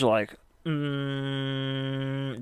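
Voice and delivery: steady drawn-out humming sound; mock droning hum; imitated hum. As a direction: hum it like a constant drone. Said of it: "this weird humming".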